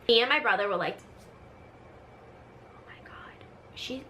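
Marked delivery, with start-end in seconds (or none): 2.7-4.0 voiceless, very soft whisper; hushed, shocked whisper to a sibling; stunned whisper. Acted. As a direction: whisper it in disbelief so mom can't hear. Siblings overhear call, react secretly.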